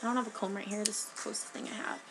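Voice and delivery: ordinary talk, nothing marked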